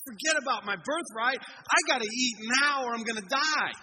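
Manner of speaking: loud, high-pitched desperate demand; urgent outburst. Retold or imitated. Hungry son giving up his birthright, urgent outburst. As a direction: blurt it out at full volume, desperate to eat. Acted Esau demanding food, starving.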